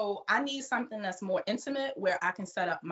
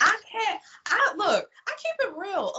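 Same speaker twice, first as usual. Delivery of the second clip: higher and louder, firmly protesting; indignant retelling; 9 semitones higher, 3 dB louder than usual. Retold or imitated. Retelling her reply to token sites, indignant and raised. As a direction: insist you keep it real, raised voice. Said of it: "I'm like".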